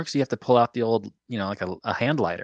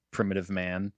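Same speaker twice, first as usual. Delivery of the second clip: ordinary talk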